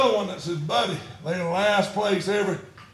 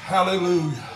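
usual speaking voice

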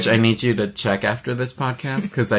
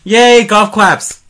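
excited; 8 semitones higher, 6 dB louder, much slower than usual. Acted. After birthday song.

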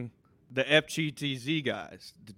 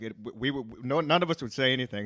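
ordinary talk, nothing marked

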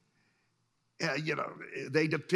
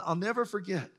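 normal talking voice

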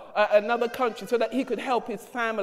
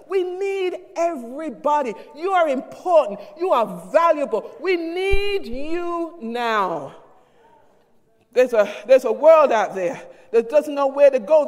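preaching; 3 semitones higher, 4 dB louder than usual. Acted. Pastor telling the church they are needed, stirring preaching. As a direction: lift the congregation up.

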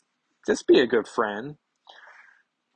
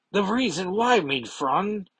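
put-on high, lightly German-accented Nightcrawler; a hint of a German accent, pitched up; 9 semitones higher than usual. Nightcrawler voiced in comic reading.

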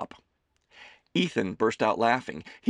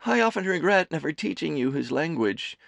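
high, loud doting mother voice; a fussing mother teasing her son; Amelie's voice; 8 semitones higher, 3 dB louder than usual. A narrator's reading. Mother serving her son lunch, high and fussing.

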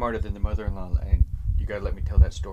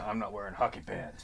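lower, quieter gravel of Batman; low Batman growl; 3 semitones lower, 7 dB quieter than usual. Retold or imitated. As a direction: murmur it like the brooding hero. Quotes it in Batman voice.

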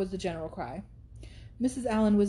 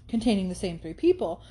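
ordinary delivery, nothing marked

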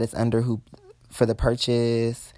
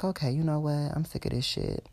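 fed-up murmur, much softer; irritated inner thought; 3 semitones higher, 10 dB quieter than usual. Retold or imitated. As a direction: say it under your breath, fading away. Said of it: "I'm irritated".

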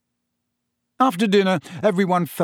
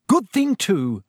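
higher, businesslike retort; brisk; 6 semitones higher, 4 dB louder, slower than usual. A narrator's reading. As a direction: chip in briskly as a busy mother. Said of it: "said Missus Weasley briskly".

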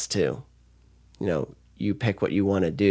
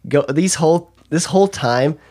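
higher, louder, thrilled gushing; excited gushing; 6 semitones higher, 6 dB louder than usual. Real, not acted. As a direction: pour out the affection, excited. Moved friend gushes about her.

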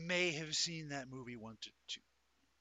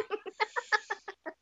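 loud high-pitched laugh; 6 dB louder than usual. Real, not acted. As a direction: squeal of laughter. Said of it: "you guys hear [name] laugh".

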